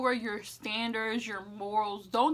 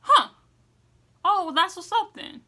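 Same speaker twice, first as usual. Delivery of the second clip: surprised approval; 9 semitones higher, 9 dB louder than usual. Retold or imitated. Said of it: "they're gonna look at you like".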